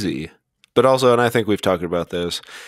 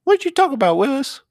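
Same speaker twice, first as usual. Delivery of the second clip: put-on squeaky, very high little-kid voice; mock-puzzled high squeal; sitcom kid catchphrase; faster than usual. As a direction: do a hammed-up falsetto child. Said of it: "the guy that goes".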